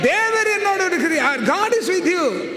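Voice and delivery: high, ringing preacher's shout; emphatic proclamation. Real, not acted. Preacher proclaims answer to congregation.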